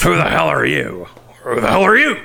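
hammed-up yell; mock shout. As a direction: bark it out, laughing. Vince staredown acted out.

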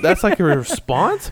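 high, slow disbelieving question; incredulous. Retold or imitated. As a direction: scoff at it in disbelief. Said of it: "I'm like".

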